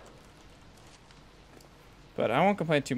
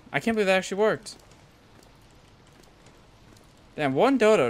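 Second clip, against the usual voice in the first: excited; 7 semitones higher, 6 dB louder than usual. Real, not acted. Amazed the dodo worked.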